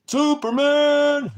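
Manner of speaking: high, slow, loud heroic shout; booming comic-book hero call; Superman cry. Pretend. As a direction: belt it out like a superhero bursting out. Hero bursting from phone booth.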